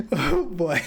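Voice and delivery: amused. Real, not acted. Amused after laughing.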